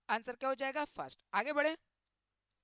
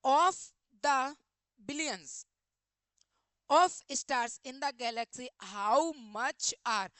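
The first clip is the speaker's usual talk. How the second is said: each word spaced out, louder; slow, loud question reading; 5 dB louder, much slower than usual. Acted. Teacher spells out the exam sentence loudly to students. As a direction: read it out as a dictation, syllable by syllable.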